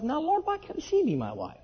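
high-pitched retold inner plea; thinking to himself. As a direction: plead inwardly with the Lord, voice pitched high. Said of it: "I'm thinking to myself".

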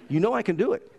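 normal talking voice

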